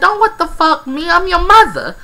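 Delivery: high, loud indignant retort; mock scolding mom. Mother telling off her disrespectful daughter, mock outrage. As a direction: imitate an outraged mother putting her child in place.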